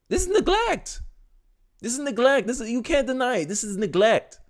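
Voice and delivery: exasperated high cry without extra volume; high-pitched indignation. Real, not acted. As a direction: pitch it way up in outraged insistence. Outraged verdict on the parenting.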